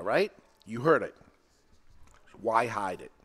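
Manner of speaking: ordinary delivery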